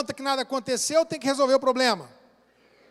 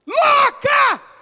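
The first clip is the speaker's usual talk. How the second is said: a high, loud acted yell of "Louca!"; mock shout; 13 semitones higher, 11 dB louder than usual. Husband acting out yelling from the car at his wife. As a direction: hurl it out like a driver's insult.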